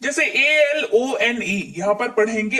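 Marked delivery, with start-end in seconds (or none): 0.3-1.7 slow spelling of एलओएनई; spelled out letter by letter. Shown as a demonstration. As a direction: spell एलओएनई slowly, one letter at a time. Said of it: "word spelling जो है उसमें ऐसे पढ़ेंगे".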